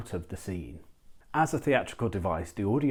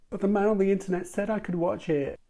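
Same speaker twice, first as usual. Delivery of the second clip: much higher put-on child voice; whiny kid voice; 12 semitones higher, 4 dB louder than usual. Young viewer defending watching a forbidden film, mock child voice. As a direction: pitch it way up like a protesting kid.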